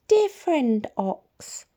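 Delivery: high, slow and sly squeak; sly rat voice. Acted. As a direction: purr it like a crafty little rat. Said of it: "said the rat with a sly grin".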